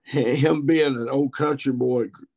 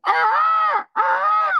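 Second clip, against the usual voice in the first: very high, loud peacock screech; peacock call; 6 dB louder than usual. Retold or imitated. Peacock screams again, very high loud screech. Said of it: "peacock again go".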